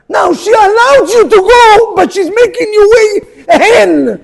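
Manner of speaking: high, loud, slow exasperation; exasperated outburst. Retold or imitated. Rabbi mocks husbands' suffering, high, loud and slow. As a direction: exclaim it in exasperation. Mocking complaint of wife.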